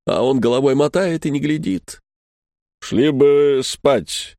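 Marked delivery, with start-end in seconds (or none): 2.8-4.4 slow, lightly voiced doorman; weary doorman. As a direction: brush him off slowly, tired. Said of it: "говорит".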